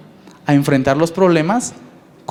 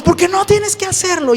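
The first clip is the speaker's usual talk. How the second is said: much higher shrieking mock yell; out-yell the kid; mother raising voice; 11 semitones higher than usual. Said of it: "la mamá levanta tres volúmenes la voz".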